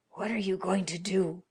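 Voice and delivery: ordinary delivery, nothing marked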